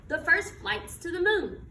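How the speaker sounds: ordinary delivery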